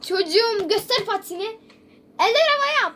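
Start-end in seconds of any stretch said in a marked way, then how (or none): 2.2-3.0 high, loud mock order; barked command. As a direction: call it out like a hold-up. Öğretmen patileri kontrol ediyor. (yüksek sesle emir)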